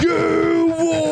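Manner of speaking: put-on yelled English accent. Greeting the English roommate coming home, yelled mock English accent. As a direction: yell it down the stairs like an English bloke. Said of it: "I like to yell".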